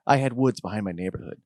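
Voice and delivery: usual speaking voice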